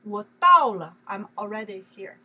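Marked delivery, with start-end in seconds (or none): 0.0-1.0 我到了 said much louder, leaning on 了; emphatic 我到了. Shown as a demonstration. Teacher drilling the 了 ending, loud and emphatic. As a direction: announce 我到了 loudly, I'm here. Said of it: "you have to plus the 了".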